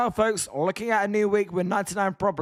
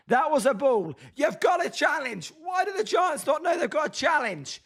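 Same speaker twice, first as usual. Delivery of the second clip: raised, exasperated pitch, protesting the call; indignant protest; 7 semitones higher than usual. Real, not acted. Fan fuming at the Giants' unused challenge, indignant. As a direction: protest in higher-pitched disbelief.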